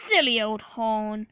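loud mocking line ending in a big laugh; hammed-up derisive laughing; Gordon laughing. A narrator's reading. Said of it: "laughed Gordon".

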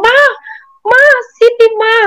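put-on a scared girl sobbing for her mother; frightened cry for mom. Screaming for mom after ghost.

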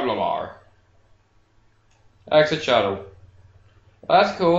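normal talking voice

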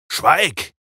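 deafening roar. A narrator's reading. Dracula silencing the blonde, deafening roar. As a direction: silence her with one roar. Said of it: "brüllte Dracula".

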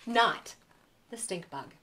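usual speaking voice

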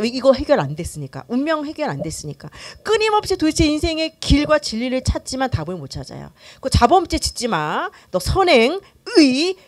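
ordinary talk, nothing marked

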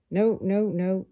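exasperated no, no, no; frustrated. Acted. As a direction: shake off the wrong rhyme, irritated. Ant frustrated with his rhyme.

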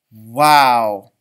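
low, loud drawn-out wow; be truly amazed; a big impressed teacher wow. A narrator's reading.

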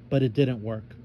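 plain reading voice

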